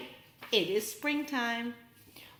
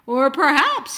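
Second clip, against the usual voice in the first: louder, a touch of fright; mock scared, building to the monster; hint of a shudder in the voice; 3 semitones higher, 8 dB louder than usual. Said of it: "he shuddered".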